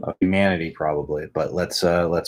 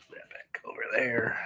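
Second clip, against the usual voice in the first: whisper; 4 semitones higher, 11 dB quieter, slower than usual. Real, not acted. Coaxing dog softly.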